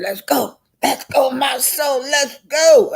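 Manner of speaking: high, rising imitation of Tisha; mock demanding Tisha. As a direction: play Tisha getting bold. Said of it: "Tisha said".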